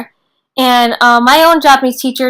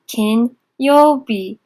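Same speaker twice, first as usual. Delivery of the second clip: very slow syllable-by-syllable; much slower than usual. Shown as a demonstration. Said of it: "teacher stretches reading slowly".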